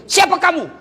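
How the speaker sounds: high, startled cry; startled shout. Acted. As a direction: jump back in shock. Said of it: "Kaget suaminya". (suami kaget berteriak ke makhluk dari sumur)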